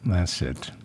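usual speaking voice

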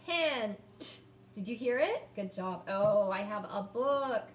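ordinary talk

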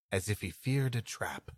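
ordinary talk

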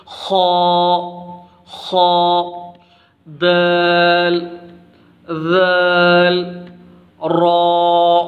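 دال and ذال stretched very slow; slow careful Arabic letters; careful letter recitation. Shown as a demonstration. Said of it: "جیسا کہ میں آپ کو پڑھ کر سناتا ہوں".

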